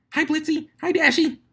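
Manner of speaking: very high, squealing hellos; tiny high-pitched pony squealing greetings; excited greeting. Acted. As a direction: wave and shriek across the crowd. Pinkie waving frantically.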